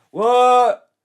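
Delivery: loud high yelp of disbelief; shocked jock. Acted. Joshua stunned by the rejection, loud high yelp.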